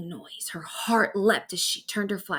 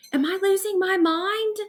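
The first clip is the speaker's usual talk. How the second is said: frazzled; 10 semitones higher than usual. A narrator's reading. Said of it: "she asked Whiskers".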